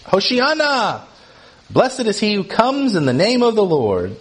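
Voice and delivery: high, drawn-out acclamation; acted shout, pitched high without full volume; crowd shouting. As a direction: cry it out like a welcoming crowd. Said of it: "they are shouting".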